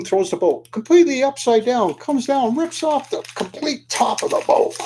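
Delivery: high-pitched dramatic narration; excited climax. A narrator's reading. Storyteller as the wave wrecks the boat, excited climax.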